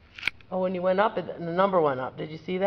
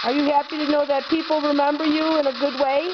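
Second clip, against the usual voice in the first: high, raised voice calling to spirits; EVP spirit call-out; 8 semitones higher than usual. Acted. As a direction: call out gently into the dark room.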